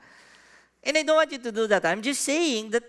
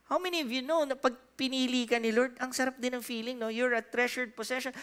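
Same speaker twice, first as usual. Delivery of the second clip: ordinary delivery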